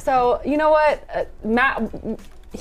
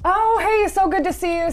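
cheery greeting; 7 semitones higher than usual. Retold or imitated. Employee greeting customer warmly.

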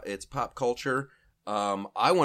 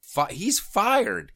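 mock outrage; 6 semitones higher, 5 dB louder than usual. Mock firing.

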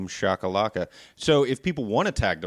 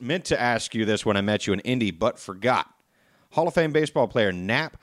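ordinary delivery

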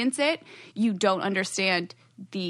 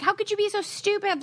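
incredulous; 11 semitones higher than usual. Retold or imitated. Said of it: "everyone was like".

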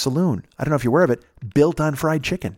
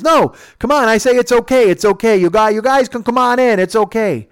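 put-on high, loud pushy drunk; drunken insisting; 9 semitones higher, 6 dB louder than usual. Bubba pushing the fence open for them, loud insisting. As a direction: holler like a drunk who won't be refused.

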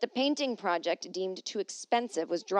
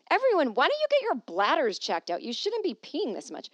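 higher, loud hammed-up telling-off; mock scolding; 6 semitones higher, 4 dB louder than usual. She tells all of Paris to get their bladders checked, loudly. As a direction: scold the whole city like a fussy nurse.

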